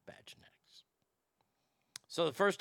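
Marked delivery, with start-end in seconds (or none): 0.1-0.8 whispered punchline. Real, not acted. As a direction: breathe it like a secret.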